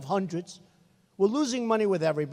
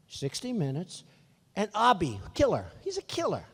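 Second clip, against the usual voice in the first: ordinary talk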